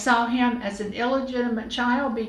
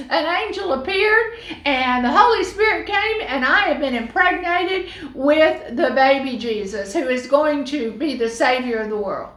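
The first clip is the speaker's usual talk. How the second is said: loud, high, excited rush of news; gushing gossip; 3 semitones higher, 4 dB louder than usual. Pretend. Mary gushing to her best friend, loud and excited. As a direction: spill it all to a best friend, thrilled. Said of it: "call up her best friend and say".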